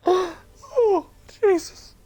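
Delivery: high voice choked with real laughter; weak, tearful with laughing; trying to recover. Real, not acted. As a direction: fight down the giggles to carry on. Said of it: "I'm trying to move on".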